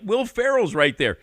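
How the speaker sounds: imitating starstruck disbelief; excited. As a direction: blurt it out like you can't believe it. Pointing out the celebrity.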